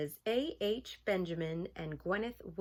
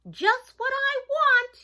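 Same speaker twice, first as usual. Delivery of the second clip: high, loud, drawn-out cry of delight; excited cry; 12 dB louder, slower than usual. Acted. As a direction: cry out as a thrilled little mouse. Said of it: "he cried".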